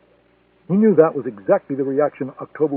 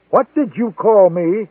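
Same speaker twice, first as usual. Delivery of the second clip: louder, higher, drawn-out question; raised-voice demand, stretched out; yelling back; 6 semitones higher, 5 dB louder, slower than usual. A narrator's reading. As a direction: yell it back slowly in affront. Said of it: "October yelled back".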